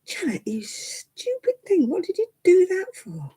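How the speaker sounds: se gronder à mi-voix, whisper puis plus haut; scolding herself. Retold or imitated. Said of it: "and you say".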